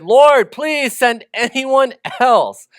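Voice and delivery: drawn-out desperate plea from Moses; pleading. Acted. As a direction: beg the Lord to send someone else. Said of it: "Moses again pleaded".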